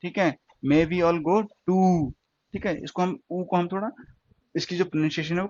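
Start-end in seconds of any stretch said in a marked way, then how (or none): 0.7-2.1 very slowly spoken, stretching go to; slow classroom example; slow example sentence. Shown as a demonstration. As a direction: read the English example slowly for learners. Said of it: "टू".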